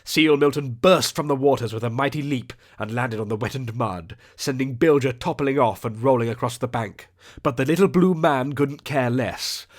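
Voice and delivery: usual speaking voice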